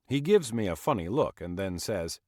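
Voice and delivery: ordinary delivery, nothing marked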